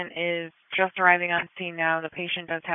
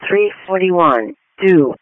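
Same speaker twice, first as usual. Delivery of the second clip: loud, measured call-out over the radio; dispatch announcement; 16 dB louder, slower than usual. Acted. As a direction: announce the call slowly and loudly.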